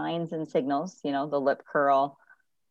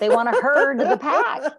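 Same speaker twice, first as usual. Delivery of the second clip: laughing; 10 semitones higher, 7 dB louder than usual. Real, not acted. Laughing about herding dogs.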